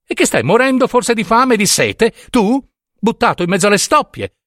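very high, indignant questioning; acted quarrelsome, incredulous pitch; getting worked up. A narrator's reading. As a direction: argue back, rising into indignation. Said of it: "disse egli alterandosi".

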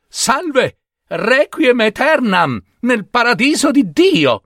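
very high, bright Babino voice; happy innocent greeting. Acted. Babino greets the wedding party, very high and cheerful. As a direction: cheerfully bless the newlyweds wrongly.

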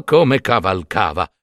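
plain reading voice

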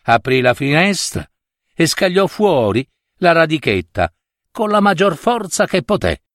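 usual speaking voice

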